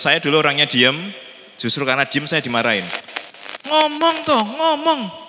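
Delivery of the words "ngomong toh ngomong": much higher, urging voice of a scolding elder. Retold or imitated. Elder scolding a silent boy to talk, high and pushy. Parent scolding a quiet child.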